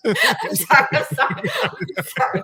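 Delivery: high, giggly sorry; laughing apology. Real, not acted. Apologising to basement dwellers, laughing.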